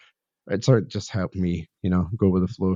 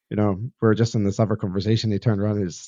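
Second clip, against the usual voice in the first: usual speaking voice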